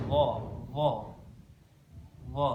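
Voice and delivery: "Dha" as heavy Arabic zha; touch tongue tip to upper teeth on "Dha"; thick, voiced th in "Dha"; tongue at tooth tips. Shown as a demonstration. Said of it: "bertemu dengan ujung".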